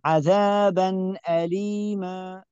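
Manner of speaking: عذابا أليما slow and clear again; correct izhar. Shown as a demonstration. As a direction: clean tanween on عذابا أليما. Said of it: "لا يوجد غنة". (تلاوة صحيحة)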